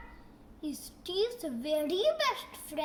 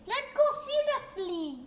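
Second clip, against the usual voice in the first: usual speaking voice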